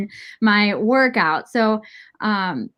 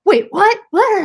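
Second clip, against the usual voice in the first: imitating dad, high and a bit louder; mock double take; 10 semitones higher, 4 dB louder than usual. Quoting her dad's shock at the trip, high. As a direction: play the baffled father's double take.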